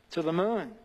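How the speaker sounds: ordinary talk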